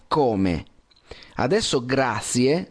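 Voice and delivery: ordinary talk, nothing marked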